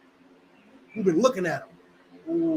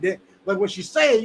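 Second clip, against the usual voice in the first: usual speaking voice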